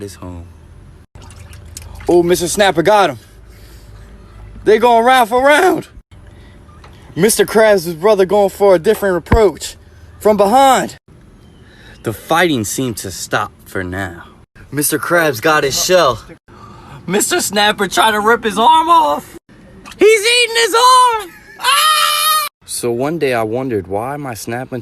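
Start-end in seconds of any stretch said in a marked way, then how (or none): 2.1-19.4 high, loud fight play-by-play; excited commentary. Real, not acted. Live commentary of turtle fight. 20.0-22.5 very high panicked yell; shocked shriek. Real, not acted. Shocked by the attack.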